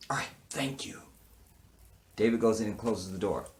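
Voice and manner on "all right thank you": quick whispered thanks; hushed retold thanks to the officer. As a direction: mutter it under your breath.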